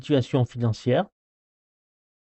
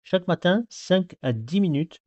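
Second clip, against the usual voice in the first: ordinary talk, nothing marked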